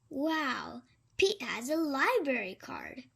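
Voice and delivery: plain reading voice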